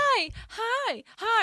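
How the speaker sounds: high-pitched imitation of a fake greeting; mock her with a put-on, sugary hi; exaggerated phony friendliness, way up high; fake friendly. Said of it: "you know that fake thing".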